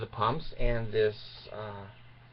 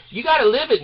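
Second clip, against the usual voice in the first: 1 loud urging; 11 semitones higher, 5 dB louder, faster than usual. Real, not acted. Urging the audience loudly.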